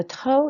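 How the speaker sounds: "trop" with the final P left silent. Shown as a demonstration. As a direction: say "trop" ending on the open o, no P sound. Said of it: "you don't say the P at all".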